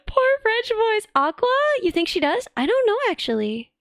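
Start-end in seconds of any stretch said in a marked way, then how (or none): 0.1-1.1 mock pity. Mock pity, high and slow.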